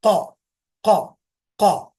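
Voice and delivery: showing students the back-of-throat क; deep throaty q. Shown as a demonstration. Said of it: "अंदर की तरफ मोटी आवाज".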